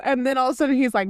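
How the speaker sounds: ordinary talk, nothing marked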